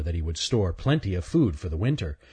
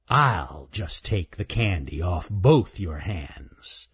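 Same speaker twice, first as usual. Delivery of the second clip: slow, menacing snarl; a bully raccoon threatening them; slower than usual. Acted. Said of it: "snarled Raker".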